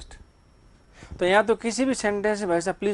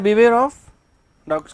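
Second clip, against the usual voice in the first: loud, higher Beware of dogs; loud sign reading; 4 semitones higher, 9 dB louder, much slower than usual. Shown as a demonstration. Teacher reading the warning sign to the class loudly. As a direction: announce the sign boldly. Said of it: "teacher reads sign aloud loudly".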